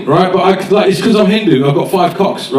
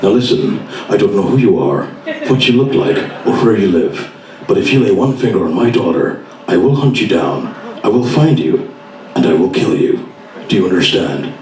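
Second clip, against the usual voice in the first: low, measured threat with heavy pauses; Liam Neeson in Taken; 6 semitones lower than usual. Acted. Father warns a caller about his daughter, low cold threat. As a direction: menace a caller, low and deliberate. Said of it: "here's my version of Taken".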